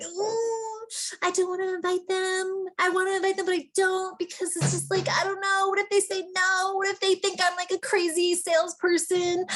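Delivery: high, whiny hand-wringing dithering; hesitant, worried inviter. Acted. Coach mimics someone afraid to invite people, whiny hesitation. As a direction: act out dreading the ask in a high voice. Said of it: "How many of us do we speak like that".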